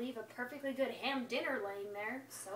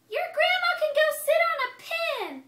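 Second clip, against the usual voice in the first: much higher and louder nasty pig voice; rude pig insult; 14 semitones higher, 11 dB louder than usual. Acted. Rude pig insults the wolf's grandma, loud high sneer. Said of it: "that porker said".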